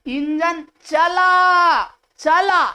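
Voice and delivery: चला thrown high and stretched after engine; stress on चला. Shown as a demonstration. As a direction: punch the whole word चला, then repeat it. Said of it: "पूरा चला पर भार दिया जा रहा है".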